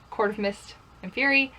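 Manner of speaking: normal talking voice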